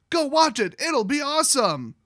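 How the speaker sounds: high, loud promoter enthusiasm; hammed-up peppy sales pitch; mock hype. Organizers telling viewers to go watch, mock excited. Mimicking organizers hyping the show.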